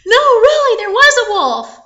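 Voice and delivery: high, loud protest; urgent child's pleading; insistent boy. Acted. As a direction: insist at the top of your voice. Boy insists to doubting parents.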